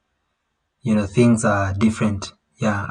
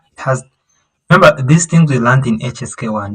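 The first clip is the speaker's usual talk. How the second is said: ordinary talk, nothing marked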